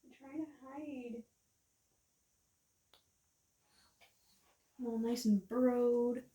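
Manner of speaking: soft, slow voice for a hiding animal; a hint of a tiny animal voice, drawn out; lightly voiced pet. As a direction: speak lightly for a shy little pet. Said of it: "It's like".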